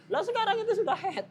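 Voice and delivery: incredulous. Real, not acted. Teacher mocks a nonsensical intention.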